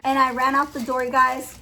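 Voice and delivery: usual speaking voice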